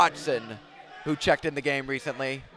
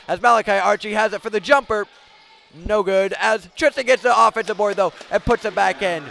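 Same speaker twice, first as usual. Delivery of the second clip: raised, quick game call; excited play-by-play; 5 semitones higher, 8 dB louder than usual. Real, not acted. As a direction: lift the voice as the play builds. Rebound and putback call.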